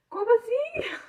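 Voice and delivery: agudo e alto, espanto meio rindo; choque encenado. Retold or imitated. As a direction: solta um susto incrédulo, imitando a própria reação. Encena o susto com o teste. (espanto encenado, bem agudo)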